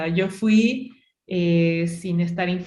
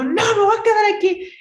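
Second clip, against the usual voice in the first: whiny giving up; 7 dB louder, faster than usual. Retold or imitated. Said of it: "y yo".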